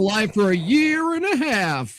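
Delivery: high, loud awards-show proclamation; mock awards announcer. Host presenting the keep-people-alive award, mock-grand announcement. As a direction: announce the winner with drawn-up, pretend fanfare.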